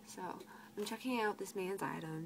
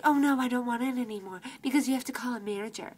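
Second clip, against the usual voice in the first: fast, loud, higher imitation; mocking customers; 4 semitones higher, 8 dB louder, faster than usual. Customers refusing to wait for a manager, mockingly imitated. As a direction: rush it out as a mock complaint. Mimicking impatient customers backing out.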